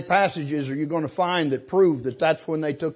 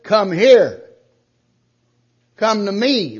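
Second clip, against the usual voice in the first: loud, emphatic preacher's command, higher and slow; 5 semitones higher, 9 dB louder, slower than usual. Acted. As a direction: thunder it out as an order. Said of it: "Or is he saying".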